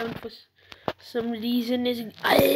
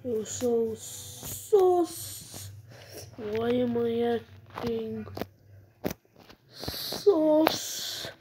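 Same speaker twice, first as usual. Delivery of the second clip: slow motion; slower than usual. Pretend. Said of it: "I'm gonna stop talking in slow motion".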